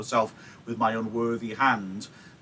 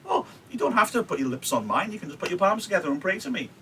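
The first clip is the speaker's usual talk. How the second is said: teasing Juliet; 7 semitones higher, faster than usual. Retold or imitated. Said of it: "she's sort of saying".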